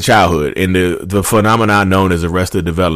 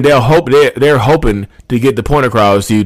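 normal talking voice

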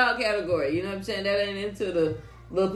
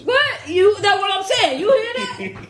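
excited; 11 semitones higher, 5 dB louder than usual. Real, not acted. Reacting loudly to the music.

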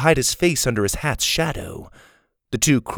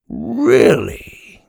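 louder, drawn-out amazement; amazed; 5 dB louder than usual. A narrator's reading. Bald man seeing the frozen boy, amazed.

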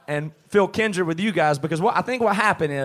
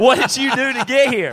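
mock challenge; 6 semitones higher, 4 dB louder than usual. Mock grilling a bandmate on stage.

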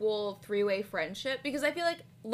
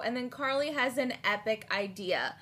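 normal talking voice

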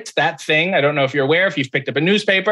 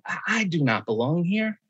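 hushed, much softer self-conscious aside; quiet aside; 7 dB quieter than usual. Retold or imitated. Awed aside on joining celebrity call.

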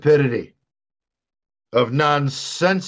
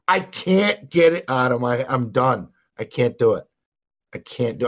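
normal talking voice

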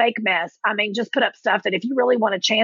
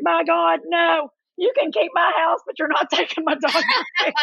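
higher-pitched mock outrage, ending in laughter; retold indignant refusal; 7 semitones higher than usual. Divorcing wife refuses her ex the season tickets, mock-indignant. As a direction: play up the refusal, half laughing.

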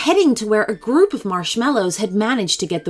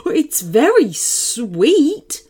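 wondering dog voice, slowing at the end; dog character, puzzled. A narrator's reading. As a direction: muse as a dog sniffing new air. Dog figure speaks in story.

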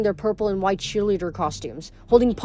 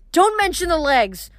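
snapped; 7 semitones higher than usual. A narrator's reading. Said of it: "Tammy snapped".

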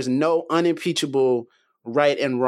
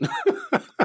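high, breathy burst of laughter; short laugh. Real, not acted. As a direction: laugh once at how absurd it is. Bitter laugh at absurd fact.

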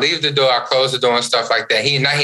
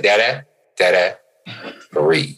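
lower, measured, with a pause before the last word; imitated calming child; 5 semitones lower than usual. Son tells his frustrated dad to breathe, calming. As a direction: coach someone to settle down, spacing each word.

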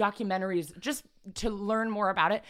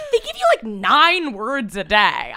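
high laughing exasperation; exasperated laugh; 7 semitones higher, 7 dB louder, slower than usual. Real, not acted. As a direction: squeal through laughter, then drag it out. Exasperated about word app.

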